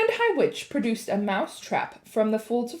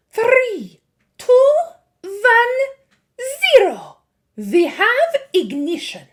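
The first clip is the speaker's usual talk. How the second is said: high, loud gleeful witch countdown; 11 dB louder, slower than usual. A narrator's reading. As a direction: count down to the spell.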